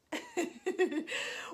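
short giggling run of laughter; playful little laugh; chuckle. Pretend. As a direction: chuckle softly to yourself. Chuckles after farmer plans cookies.

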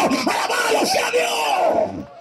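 high shouted rebuke; fervent preaching. Acted. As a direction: raise the roof with the correction.

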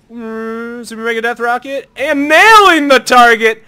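high, loud, stretched hype shout; hype announcer call. Real, not acted. Hype call of a big hit.

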